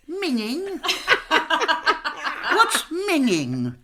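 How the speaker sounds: the Queen puzzled and giggling at slang; laughing Queen. Retold or imitated. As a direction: laugh the word "Minging?" up high. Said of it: "And the Queen went".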